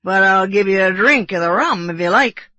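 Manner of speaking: loud tipsy sailor, lightly voiced; drunken bosun. A narrator's reading. Drunk bosun offering Kiawe rum, loud and mildly tipsy. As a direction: offer it with a touch of drunken heartiness. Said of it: "how drunken it appeared".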